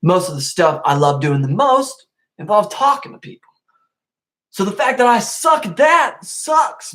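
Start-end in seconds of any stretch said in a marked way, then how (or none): 4.5-6.9 high, slow, whiny complaint; self-mocking whine. Real, not acted. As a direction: whine about your own flaw. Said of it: "self-mocking whine".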